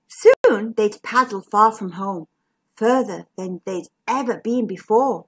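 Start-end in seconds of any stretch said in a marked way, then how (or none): none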